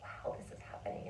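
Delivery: hushed, amazed whisper; awed whisper. Retold or imitated. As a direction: whisper it in quiet wonder.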